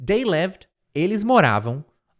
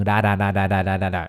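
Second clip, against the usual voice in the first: flapped R demo; 7 semitones lower than usual. Shown as a demonstration. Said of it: "parece um som de R".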